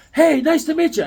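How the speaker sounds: loud, high-pitched eager hello; mimicked super-friendly greeting. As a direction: greet like an over-friendly stranger. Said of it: "he's talking super friendly".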